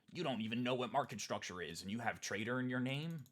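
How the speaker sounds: mocking critics. Quoting critics who mocked him, lightly sneering. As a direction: sneer like a know-it-all. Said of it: "people came in and said".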